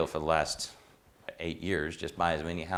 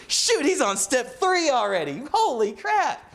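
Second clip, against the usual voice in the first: much higher and louder, slow on the second line; hammed-up praise; 5 dB louder, slower than usual. Old mentor crowing to others about the student, high hammed-up praise. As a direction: crow it like a proud old-timer. Old man amazed again.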